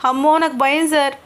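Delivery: disgusted and scared. Acted. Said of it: "అసహ్యంగా face పెట్టి అంటోంది".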